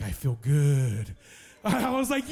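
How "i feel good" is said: low, drawn-out, contented answer; slow it right down and drop the voice deep; new convert's reply. Retold or imitated. New convert answers how he feels after praying, low and slow.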